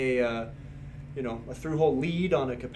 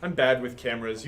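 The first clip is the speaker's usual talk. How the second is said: ordinary talk, nothing marked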